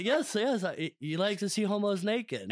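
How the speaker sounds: high mock movie character, laughing; hammed-up quote with a laugh in it; Joe Dirt movie impression. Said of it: "like on Joe Dirt".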